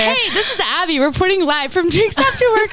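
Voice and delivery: upbeat live TV reporter; news reporter voice. Retold or imitated. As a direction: announce it like a field reporter. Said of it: "like, a news reporter".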